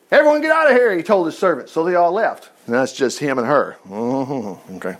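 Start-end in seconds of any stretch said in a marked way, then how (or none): 0.1-1.0 high, raised-voice order; commanding. A narrator's reading. Amnon orders his servants out of the room, commanding. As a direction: order them out, pitched up and loud.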